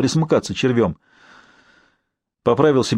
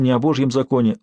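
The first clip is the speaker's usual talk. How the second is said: plain reading voice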